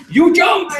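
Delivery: high, loud mock accusation; a loud, high playful jab; teasing shout. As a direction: call it out like a family ribbing him. Family teasing him after the jump.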